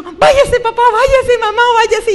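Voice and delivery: high, loud pleading yells to send them away; desperate shout. Retold or imitated. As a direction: cry out for the parents to leave. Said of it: "Y yo empezó". (gritos altos y desesperados: váyase)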